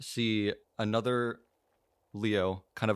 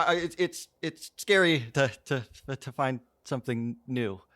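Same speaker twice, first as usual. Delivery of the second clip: halting, stammering higher voice; hesitant worrier, pitched up; anxious; 5 semitones higher than usual. Acted. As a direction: stumble nervously over every few words. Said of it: "Anxious goes".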